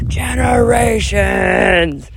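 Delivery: high, slow, stretched shout; faux yell. Proclaiming her voice to viewers, drawn-out shout. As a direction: let the word rise into a drawn-out yell. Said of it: "speaking with a voice".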